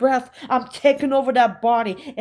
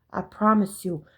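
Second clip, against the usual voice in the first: usual speaking voice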